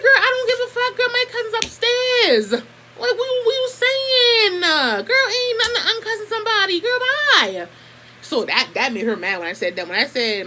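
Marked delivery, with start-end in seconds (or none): 0.0-7.7 high, loud, drawn-out sass; high-pitched dismissive clapback; mock taunt. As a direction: taunt her in a high, stretched-out voice. Acted quote of herself taunting cousin.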